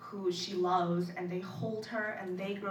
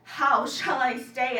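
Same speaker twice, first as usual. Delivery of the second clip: loud poetic cry to the ground; desperate shout; 4 semitones higher, 10 dB louder than usual. Acted. Poet shouting to the ground, loud dramatic plea. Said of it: "I shout to the ground".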